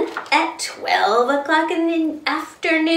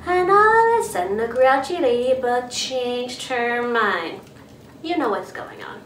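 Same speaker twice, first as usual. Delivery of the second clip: ordinary delivery, nothing marked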